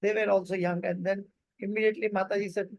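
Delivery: ordinary talk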